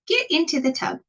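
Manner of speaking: high, bossy witchy demand; witch's order. Acted. The witch commands Sasha into the tub, high and bossy.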